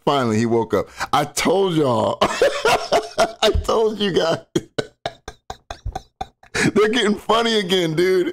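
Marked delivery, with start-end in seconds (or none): 1.1-6.7 drawn out slowly, laughing; laughing slow mock quote. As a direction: stretch it out while cracking up.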